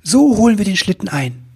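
high, thrilled outburst; excited cry. Acted. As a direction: burst out with a sudden idea. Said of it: "rief er voller Begeisterung".

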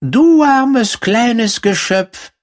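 field mouse voice. A narrator's reading. Field mouse to the poor girl at her door, high-pitched mouse voice. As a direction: pity the poor creature in a high, drawn-out squeak.

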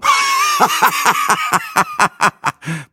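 very high, long cackling laugh; snorting guffaw. A narrator's reading. Show-off laughing at his own joke, guffawing. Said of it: "prustete Breitling".

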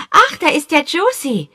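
put-on high, loud boyish exclamation; a kid spotting a friend; excited boy. A narrator's reading. Boy Peter spotting Josie, high excited voice.

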